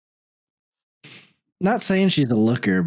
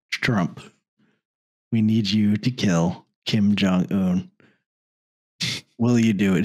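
deep official voice; 7 semitones lower than usual. Retold or imitated. Officials asking Trump.

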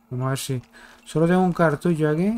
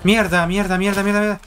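loud panicked swearing, pitched higher; cursing under breath; 6 semitones higher, 4 dB louder than usual. Real, not acted. Panicked swearing during an attack.

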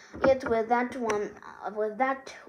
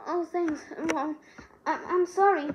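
tearful; 6 semitones higher than usual. A narrator's reading. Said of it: "burst into tears".

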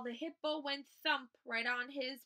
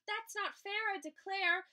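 high, indignant bear protest; storybook bear crying foul; 7 semitones higher, 4 dB louder than usual. A narrator's reading. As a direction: protest it loudly, pitched up. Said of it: "cried the bear".

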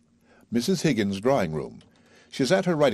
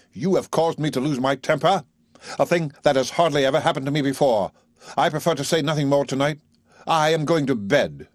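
higher, clipped offended gentleman; stiff dignified Higgins; 7 semitones higher than usual. A narrator's reading. Higgins announcing to Eliza he is going to bed, stiffly. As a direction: hold your temper with stiff dignity.

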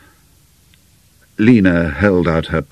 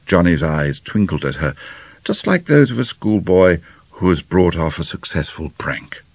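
ordinary delivery

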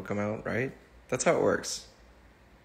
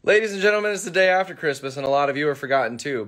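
yelling over helicopter; 5 semitones higher, 5 dB louder, faster than usual. Acted. Announcer opening, loud and high.

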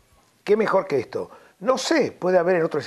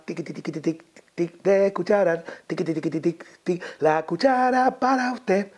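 put-on old-time radio jingle; 4 semitones higher than usual. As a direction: imitate a silly vintage jingle with rhythmic filler. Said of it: "porque era un jingle de corte antiguo".

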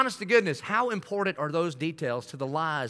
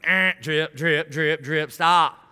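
mock dripping interruption; 4 dB louder, much slower than usual. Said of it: "And I'm like".

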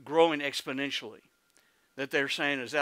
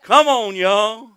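loud, high Oklahoman holler; Oklahoma twang; 7 semitones higher, 13 dB louder, slower than usual. Shown as a demonstration. Preacher rallying the congregation, loud Oklahoma twang. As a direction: belt it out in an Oklahoma accent. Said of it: "I know how to talk Oklahoman".

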